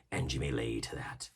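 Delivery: soft, low menacing close; quiet threat. A narrator's reading. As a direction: drop it to a whisper. Silver's final quiet threat.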